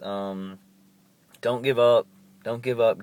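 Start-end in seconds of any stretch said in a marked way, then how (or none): 1.4-3.0 louder, pressing urge; a loud, earnest plea not to give up; emphatic plea. Real, not acted. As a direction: push it out to the viewers, insistent. Urging viewers before signing off.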